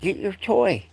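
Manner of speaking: high sing-song call to a dog; pet voice. Real, not acted.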